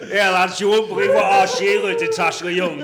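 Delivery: loud, high hammed-up Jamaican accent; a bad Jamaican accent, shouted. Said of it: "Was that your Jamaican voice?".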